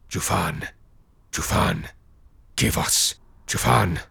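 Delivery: hushed eerie spirit chant; breathy ghostly chanting; echoing ethereal energy being. A narrator's reading.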